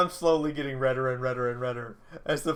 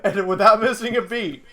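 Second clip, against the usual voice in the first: said through laughter, louder; laughing; 4 semitones higher, 7 dB louder than usual. Real, not acted. Telling friends the punchline, laughing.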